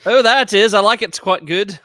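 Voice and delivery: loud, high put-on British approval; mock British accent. Co-host praising another's attempt at the game, mock British accent. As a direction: cheer them on in an exaggerated British accent. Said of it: "Can we play it in British accents?".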